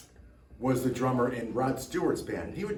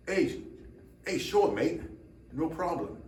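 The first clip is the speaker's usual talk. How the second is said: higher-pitched, badly faked British accent; 5 semitones higher than usual. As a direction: put on a poor British accent. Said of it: "Bad accent".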